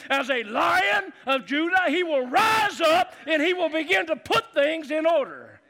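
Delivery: loud, high, building preacher's shout; preaching shout. Real, not acted. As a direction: roar it out like a Lion of Judah. Preacher rising to full shout.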